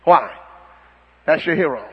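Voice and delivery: usual speaking voice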